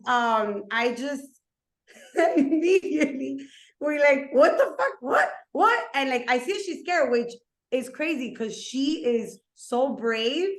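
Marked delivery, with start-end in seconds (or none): 4.3-5.8 loud, high startled "what the fuck, what, what"; startled shout. Retold or imitated. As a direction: yelp it in shock, pitch jumping up. Startled reaction to a scare.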